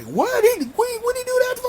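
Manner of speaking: high-pitched, incredulous retold squeal; baffled reaction. Fan recalling his reaction to the match, high baffled disbelief. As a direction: voice leaps way up in mock disbelief.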